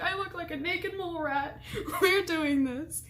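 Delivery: amused laughing quote; laughing through it. Retold or imitated. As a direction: giggle while repeating the joke. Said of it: "I was like".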